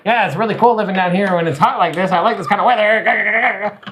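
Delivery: a hint of the new barista's mellow voice; imitating barista. Retelling the barista's small talk, mild mimicry. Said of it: "This is exactly how he sounds".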